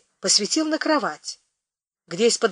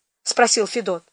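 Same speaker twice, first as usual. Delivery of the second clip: ordinary delivery, nothing marked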